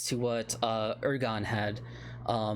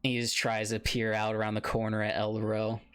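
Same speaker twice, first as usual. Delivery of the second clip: usual speaking voice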